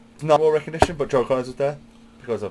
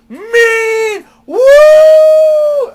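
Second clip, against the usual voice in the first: much higher, much louder wrestling-fan woo; Flair woo shout; 16 dB louder than usual. Retold or imitated. Fan in the crowd screaming at Ric Flair. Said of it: "you hear this fucking mark on myself going".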